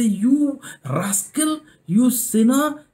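slow, high-pitched mock rebuke; mock scolding. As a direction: draw out a hammed-up telling-off. Acts out a scolding God didn't give.

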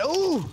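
excited ooh. Real, not acted. Big play reaction.

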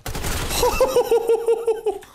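high burst of laughter. Real, not acted. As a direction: cackle loud and high. Laughing after the fight.

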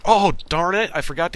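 sudden loud frustrated cry; annoyed outburst. Real, not acted. Forgot the power armor.

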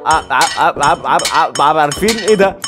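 ordinary delivery

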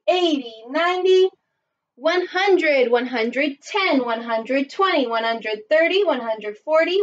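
upbeat counting-along teacher voice; kids show host. Acted. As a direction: lead the little ones counting, cheerful. Leads children counting by tens.

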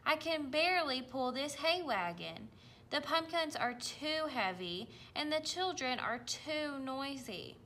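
a small tractor grumbling about its load; complaining little tractor. Acted.

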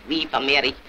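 ordinary talk, nothing marked

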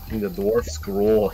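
"The dwarf scroll" very slow, stretched "scroll" O; drawn-out O. Retold or imitated. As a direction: lean hard on the long O of "scroll". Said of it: "you really leaned in on the O there".

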